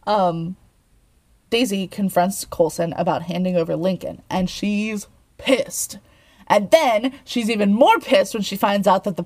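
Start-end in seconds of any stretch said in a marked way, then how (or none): none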